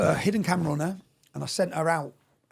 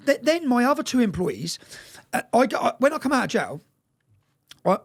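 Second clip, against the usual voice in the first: ordinary talk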